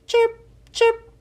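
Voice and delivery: high, bright chirping cricket voice. A narrator's reading. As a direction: chirp it like a tiny singing cricket. Crickets singing.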